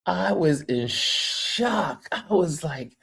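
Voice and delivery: ordinary delivery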